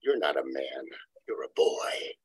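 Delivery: low, quiet, sneering put-down; wife on the couch sneering at her husband. Retold or imitated. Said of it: "She looked at him with a sneer".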